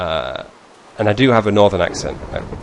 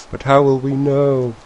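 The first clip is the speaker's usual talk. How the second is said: higher-pitched mock lament; mock dismay; 5 semitones higher than usual. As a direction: whine it as a put-on complaint. Mock distress at skipped advert.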